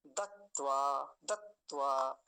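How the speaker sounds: दत्वा as dat-tvaa linked, both t's kept; parts joined. Shown as a demonstration. Teacher showing both halves put together, parts joined. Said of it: "दोनों को जोड़ देंगे".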